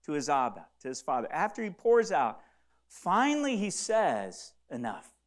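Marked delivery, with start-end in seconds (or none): 4.7-5.1 soft resolve. Acted. Jesus ends his grief.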